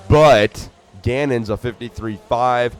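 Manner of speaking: loud, slowed lap-time call; booming announcer calling lap times; announcer callout. Acted. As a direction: read the times out to the stands.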